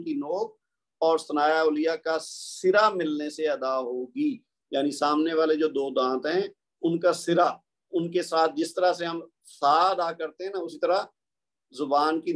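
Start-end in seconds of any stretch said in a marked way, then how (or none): none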